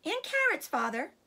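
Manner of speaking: put-on high, childlike piping up; little fox appealing to father; smallest young fox. A narrator's reading. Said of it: "said the smallest of the three small foxes".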